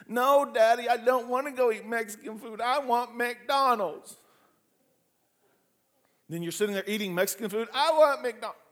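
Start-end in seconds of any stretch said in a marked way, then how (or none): 0.1-4.2 imitating a kid begging for McDonald's, higher and slower at the end; a child pleading with daddy for McDonald's; whiny kid. Kid whines to daddy for McDonald's, mock pleading. 7.7-8.6 mock child's whine, higher and louder; whiny kid. Kid insists on McDonald's to his dad, mock whine.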